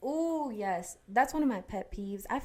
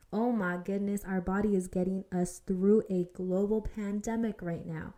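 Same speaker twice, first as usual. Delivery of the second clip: ordinary talk, nothing marked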